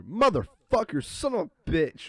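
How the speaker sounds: loud, slow, high outburst of swearing; mock cursing shout. Boss cursing after hitting something, loud slow swearing. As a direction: blurt the curses out, drawn out. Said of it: "I just hear".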